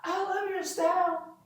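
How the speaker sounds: soft, high, slow coo; gently sung-out mock compliment; said nicely. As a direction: imitate her sweet compliment, high and drawn out. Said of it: "she said it nicely".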